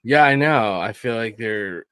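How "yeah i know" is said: ordinary talk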